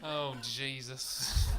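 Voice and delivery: drawn-out weary sigh of words; exasperated groan at the customer; slow exasperation. Retold or imitated. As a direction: let it drag out, exasperated. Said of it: "And I went".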